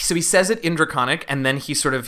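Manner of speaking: ordinary talk, nothing marked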